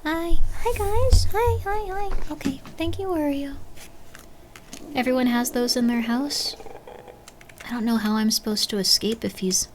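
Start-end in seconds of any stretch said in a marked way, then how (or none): 0.0-3.6 high cooing voice to the cats; high, sweet pet talk. Real, not acted. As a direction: coo at the pets.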